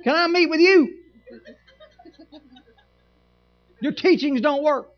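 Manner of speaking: mock complaining member. Unhappy church member demanding a meeting, mock indignant. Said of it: "everybody that's not doing it".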